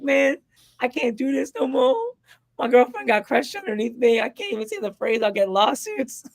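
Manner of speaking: high-pitched comedian's complaint; hammed-up, high comic griping; Dave Chappelle. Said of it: "Dave Chappelle".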